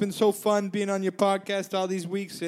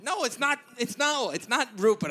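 ordinary talk

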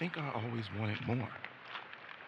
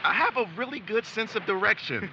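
much higher, louder defensive claim; defensive insistence; 11 semitones higher, 9 dB louder than usual. Acted. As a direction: huff back, wounded pride. Defensive about the map.

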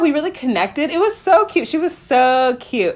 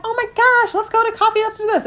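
much higher, squealing excitement; overeager excitement; 12 semitones higher, faster than usual. Retold or imitated. Eager match proposing coffee, much higher and squealing. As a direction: squeal like she can't wait.

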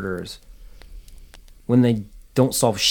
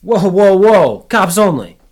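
much louder, higher warning shout; shouting stop; 10 semitones higher, 12 dB louder, slower than usual. A narrator's reading. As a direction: halt someone at the tape. Uniform stops him at tape.

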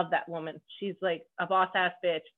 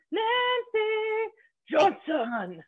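announcer-style introduction; 10 semitones higher, much slower than usual. Real, not acted. Host presenting her guest, drawn-out announcer call. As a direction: stretch the name out like a ring announcer.

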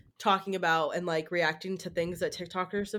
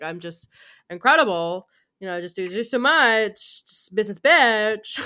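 mock brag; 2 semitones higher, 8 dB louder than usual. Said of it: "bragging about herself".

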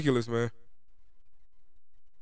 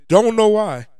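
loud, higher frustrated New York accent; 8 semitones higher, 9 dB louder, slower than usual. Real, not acted. Vlogger apologising to viewers for slipping out, frustrated New York accent. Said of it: "this New York accent just comes out".

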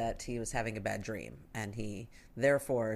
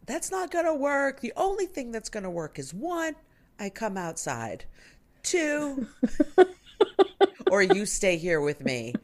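put-on high insistent child voice; imitating son; 9 semitones higher, 5 dB louder than usual. Son refusing mom's advice, high stubborn child voice. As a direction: lay down the only options like a stubborn kid. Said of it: "he said".

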